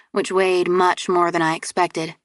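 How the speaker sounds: ordinary delivery, nothing marked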